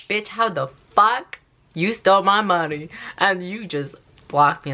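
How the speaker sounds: imagined angry confrontation; pretend confrontation. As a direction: confront the thief who blocked you.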